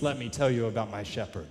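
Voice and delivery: ordinary delivery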